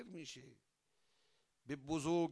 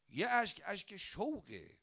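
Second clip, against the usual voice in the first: emphatic proclaim; 8 semitones higher, 5 dB louder than usual. Real, not acted. Preacher proclaims tears of joy.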